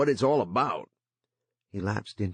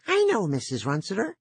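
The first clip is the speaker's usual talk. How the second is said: put-on eager, higher boyish voice; young boy voice; 11 semitones higher, 3 dB louder than usual. A narrator's reading. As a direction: play the lonely boy, keen to chat. Jory speaks through the line.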